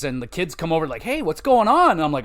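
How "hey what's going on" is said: put-on high, louder little-kid call; curious kid voice. Kids at the park running over, high and curious. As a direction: pipe up like a nosy child.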